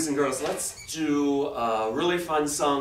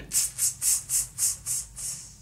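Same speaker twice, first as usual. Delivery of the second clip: voiceless monkey "tss" noises in a run; monkey chatter; 8 dB quieter, slower than usual. Acted. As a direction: hiss short tss sounds like mimicking monkeys. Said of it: "monkeys only stamped their feet and said".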